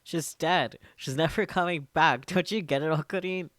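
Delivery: loud, high anguished shouting; furious yell. Acted. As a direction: scream the loss at your friend. Daru yells at Okabe.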